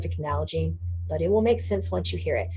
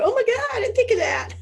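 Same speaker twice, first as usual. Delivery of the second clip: very high, laughing mock astonishment; amazed realization; 4 dB louder, faster than usual. Teacher imitating people grasping her lesson, amazed. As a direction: imitate a student's squealed revelation through laughter. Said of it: "I love when people go".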